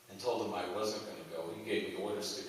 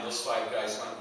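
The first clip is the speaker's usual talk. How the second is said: ordinary talk, nothing marked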